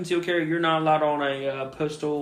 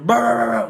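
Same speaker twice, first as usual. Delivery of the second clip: high, loud rolled dog bark; imitated angry barking; 8 semitones higher, 7 dB louder than usual. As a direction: go off like a mean guard dog. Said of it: "that dog lit me up".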